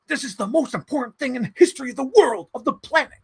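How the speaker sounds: high, earnest mock movie gravitas; Matt Damon. Mocking the actor's grave Oppenheimer line, high and solemn. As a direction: ham up a deadly serious scientist. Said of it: "Matt Damon just doing, like".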